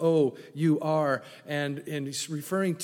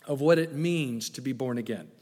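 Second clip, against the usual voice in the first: usual speaking voice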